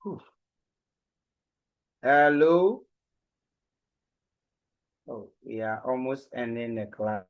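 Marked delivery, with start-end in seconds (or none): none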